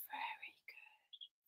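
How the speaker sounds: very slow, hushed whisper; whispered praise. Real, not acted. Praises children standing frozen.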